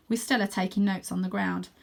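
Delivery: ordinary delivery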